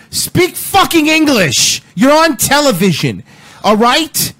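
mock yell. Host yells at the news reporter on TV, a mock scolding yell. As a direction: bark it at the TV like a furious viewer.

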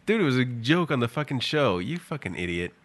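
ordinary talk

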